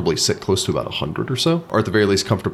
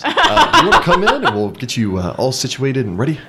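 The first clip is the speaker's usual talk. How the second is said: laughing host; 7 semitones higher, 5 dB louder than usual. Acted.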